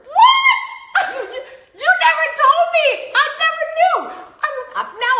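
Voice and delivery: very high, loud, fast laughing shout; laughing outrage. Retold or imitated. Confronting husband about beaver nickname, laughing shout. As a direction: shriek your protest through laughter.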